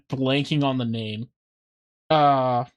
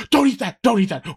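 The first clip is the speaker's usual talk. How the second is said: higher, hammed-up panicky warning; mock urgent warning; 6 semitones higher, 4 dB louder than usual. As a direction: blurt a frantic mock warning. Acts out slapping sandwich away.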